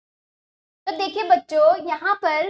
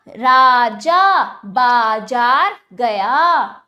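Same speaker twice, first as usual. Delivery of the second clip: slow drawn-out reading; 3 semitones lower, 8 dB louder, much slower than usual. Shown as a demonstration. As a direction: stretch every आ in आज राजा बाजार, slow and loud. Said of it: "और ज्यादा खींच के पढ़ा जाएगा". (बच्चों के लिए आ खींचकर धीरे और ज़ोर से पढ़ना)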